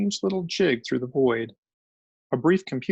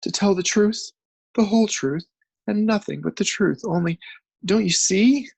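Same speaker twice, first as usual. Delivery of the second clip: much higher, louder, urgent; rising distress; 7 semitones higher, 5 dB louder than usual. A narrator's reading. Journalist desperately pleading with the crew. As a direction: build to a desperate plea.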